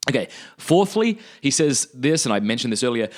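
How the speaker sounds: usual speaking voice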